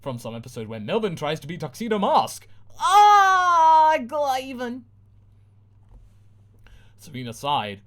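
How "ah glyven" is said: high loud wail; cry out. Acted. Ditzy girl being eaten, crying out. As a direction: scream as you vanish.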